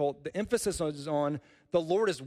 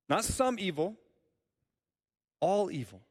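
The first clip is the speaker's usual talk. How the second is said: "some" raised high, then "all evil" firm; contrast some and all; 4 semitones higher than usual. Shown as a demonstration. Preacher contrasting some evil with all evil. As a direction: set "some" against "all". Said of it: "Just notice the scope here".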